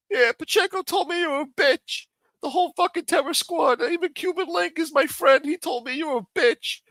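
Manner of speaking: high, far, silly falsetto taunt; mocking squeaky voice. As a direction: mimic him like a petty child. Mocking quote of trash talk.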